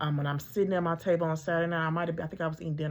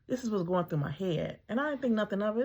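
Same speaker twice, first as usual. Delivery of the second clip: ordinary talk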